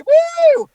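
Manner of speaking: high, loud whoop; imitated audience "woo"; crowd cheer. Comic imitating the crowd after the stunt, high cheer.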